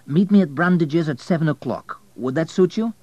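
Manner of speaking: lifted, eager arranging voice; eager invitation. Acted. Abel eagerly proposes a dinner date.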